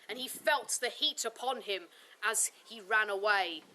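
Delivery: ordinary talk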